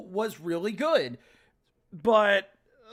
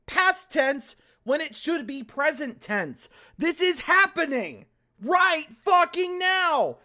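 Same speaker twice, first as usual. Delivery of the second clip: annoyed lecture; 6 semitones higher, 3 dB louder than usual. Real, not acted. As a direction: scold the writer, fed up. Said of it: "getting so angry".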